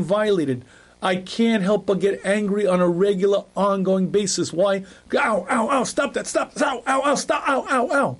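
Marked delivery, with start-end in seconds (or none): 5.1-8.2 higher, whiny repeated ows; mock pained yelps. Arrogant ego feeling insulted by everyone, whiny mock ows. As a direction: yelp like every poke hurts.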